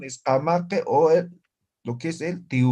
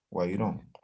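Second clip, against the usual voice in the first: normal talking voice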